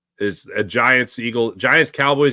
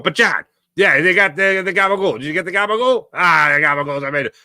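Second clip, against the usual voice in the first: light mock Italian-American, higher; Jersey Italian bit; 5 semitones higher than usual. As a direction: ham up the gabagool. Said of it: "That's how my brother and I do a bit".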